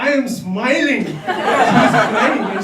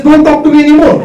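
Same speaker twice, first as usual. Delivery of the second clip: louder, raised mock scolding; retold angry punishment; 4 semitones higher, 6 dB louder than usual. Wife punishing her husband, mock angry. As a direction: imitate a wife laying down the law.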